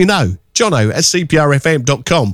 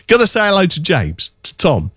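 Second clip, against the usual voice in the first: ordinary delivery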